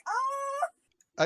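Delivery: high, sudden yelp of alarm; a quick shocked squeal; startled shriek. Retold or imitated. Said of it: "I was like".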